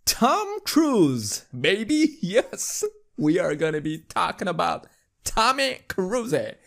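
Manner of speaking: high, playful announcer excitement; hammed-up hype. Hyped announcement of favorite news item.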